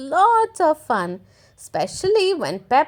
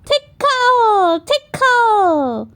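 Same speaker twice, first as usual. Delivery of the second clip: put-on high, louder teasing child; playful tickle; 8 semitones higher, 5 dB louder, slower than usual. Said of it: "said George".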